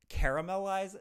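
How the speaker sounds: "caramelize" as CARE-a-mel-ize, every syllable sounded; too many syllables. Shown as a demonstration. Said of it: "that's too many syllables".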